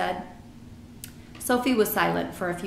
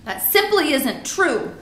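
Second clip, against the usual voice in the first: slow, loud, higher-pitched denial; angry cry; 8 semitones higher, 6 dB louder than usual. A narrator's reading. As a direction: contradict indignantly, stretching the words. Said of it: "Sophie cried angrily".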